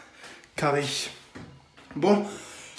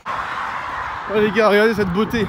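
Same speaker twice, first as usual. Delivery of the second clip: loud, high and laughing; excited, laughing; 5 semitones higher, 7 dB louder, slower than usual. Real, not acted. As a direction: burst out at the view. Shows view while running, laughing.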